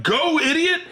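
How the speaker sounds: high, punched imitation of a pushy friend; exasperated urging. Comic friend urging doorman after the woman, exasperated.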